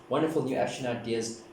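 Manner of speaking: usual speaking voice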